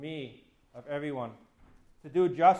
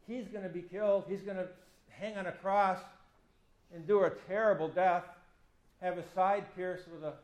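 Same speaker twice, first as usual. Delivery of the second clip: ordinary delivery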